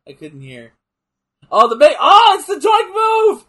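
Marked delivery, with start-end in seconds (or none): 1.5-3.4 loud and high, thrilled at the move; real excited gamer shout. Real, not acted. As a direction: yell it out with delight.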